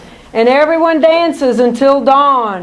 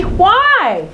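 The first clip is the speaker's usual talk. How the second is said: puzzled; 7 semitones higher than usual. Acted. Child puzzled.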